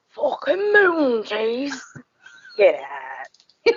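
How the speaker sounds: a gruff East End hardman rasping it out; Phil Mitchell voice. Retold or imitated. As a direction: Phil Mitchell-style growl. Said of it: "I sound like Phil Mitchell".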